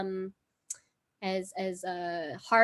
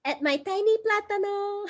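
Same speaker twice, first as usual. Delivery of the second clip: cutesy tiny voice; 11 semitones higher than usual. Pretend.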